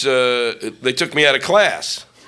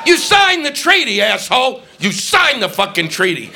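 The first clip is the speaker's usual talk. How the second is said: mocking shout; 8 semitones higher than usual. Mocking other nations.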